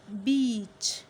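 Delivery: loud, drawn-out बीच; long E. Shown as a demonstration. Teacher models a long-vowel word, stretched बीच. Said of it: "ई की आवाज़ आ रही है".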